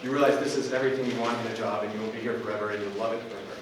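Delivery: usual speaking voice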